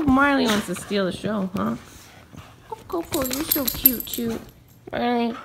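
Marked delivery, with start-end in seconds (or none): none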